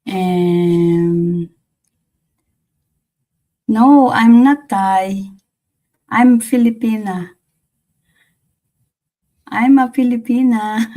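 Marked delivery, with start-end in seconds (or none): 3.7-10.9 louder, higher, firmly setting it straight; emphatic correction. Real, not acted. Answering a viewer who guessed wrong, louder emphatic correction. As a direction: say it like you're setting the record straight.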